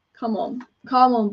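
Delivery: ordinary talk, nothing marked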